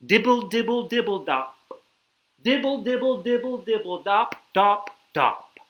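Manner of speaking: high, pattering dibble-dops; rain sound. Acted. Reader tries rain for kids, high pattering. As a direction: patter it out like raindrops. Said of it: "He can sound like the rain".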